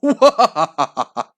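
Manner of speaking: loud high monster cackle; wild laugh. Acted. Vampire laughing wildly as he lunges, loud cackle. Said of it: "Laughing wildly".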